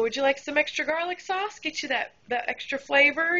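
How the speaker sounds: high, overly friendly offer; sweet server voice. Retold or imitated. Server offering the customer extra sauce, sugary high voice. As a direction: sell it with a fake smile.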